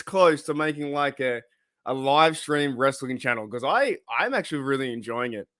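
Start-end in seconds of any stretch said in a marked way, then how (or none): none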